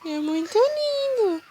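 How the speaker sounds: high squeaky cooing praise. Real, not acted. Mother praising baby, higher take.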